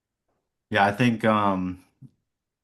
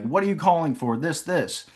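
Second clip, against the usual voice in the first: mild mock yell, pitched higher; higher-pitched, half-hearted angry retort; imitated irate client; 5 semitones higher than usual. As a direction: imitate an annoyed person answering a sales call. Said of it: "pissed off, yell at me".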